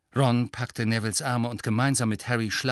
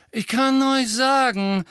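high, slow, raised sneering voice; Malfoy sneering; 13 semitones higher, 7 dB louder than usual. A narrator's reading. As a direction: sneer it out loudly, drawn out. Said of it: "hob die Stimme noch ein wenig".

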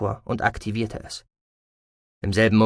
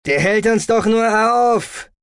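high, loud, drawn-out hiss of impatience; hissing intruder; 11 semitones higher, 6 dB louder, slower than usual. A narrator's reading. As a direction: hiss it slowly and forcefully, pitched high. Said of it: "zischte ein weiterer Eindringling". (laut und gedehnt zischend)

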